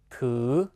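"t" syllable drawn out in the rising fifth tone; fifth-tone demonstration. Shown as a demonstration. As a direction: model "t" twice, gliding up slowly. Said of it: "读五声".

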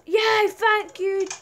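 high, loud, slow cheer; joyful. Real, not acted. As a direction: celebrate the door opening. Door finally opens.

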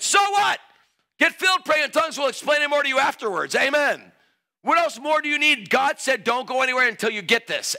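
high, loud preaching push; forceful preaching. Real, not acted. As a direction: press the challenge home to the crowd.

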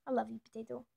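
normal talking voice

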